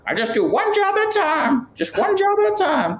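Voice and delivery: put-on very high, thin old-man voice; squeaky elderly man's voice; old roofer voice. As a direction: play the eighty-four-year-old roofer, cracking high. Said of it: "I'm gonna do the voice".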